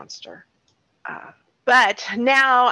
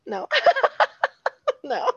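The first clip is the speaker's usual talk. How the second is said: laughing; 3 dB louder than usual. Real, not acted. Mocking her own question, laughing.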